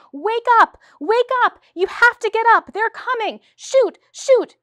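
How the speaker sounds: high, loud, frantic cries to wake sleeping partisans; screaming alarm. Acted. Heroine screaming a warning to her sleeping comrades, loud and high. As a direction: scream the warning as if the Nazis are coming. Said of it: "frightened herself with her screams".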